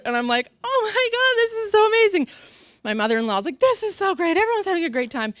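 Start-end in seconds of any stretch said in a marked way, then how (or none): 0.6-2.3 high-pitched, gushing delight; gushing excitement. Retold or imitated. Acts out her gushing reaction. 3.6-5.3 high, chirpy mother-in-law delight; imitated delight. Mother-in-law's delight acted out.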